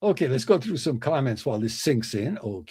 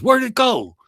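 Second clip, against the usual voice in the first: eager golfer; 11 semitones higher, 7 dB louder than usual. Acted. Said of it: "And he says".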